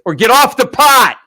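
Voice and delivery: loud, high bellowed ultimatum; hammed-up shouted demand; mock exasperated yell. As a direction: holler it like a fed-up fiancée. Acts out wife's exasperated proposal.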